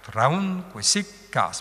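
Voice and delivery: "traun quisic cas" slow, long syllables drawn out; scanned iambs. Shown as a demonstration. Teacher points out two iambs, slow scanned reading. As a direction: separate the feet slowly, stretch "cas". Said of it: "Mais le cas est long".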